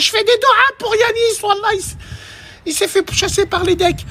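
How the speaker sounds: put-on high-pitched gossiping girl voice; imitated girl on a call. Girl phoning her friend Shaima, imitated high-pitched voice. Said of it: "elle appelle Shaima".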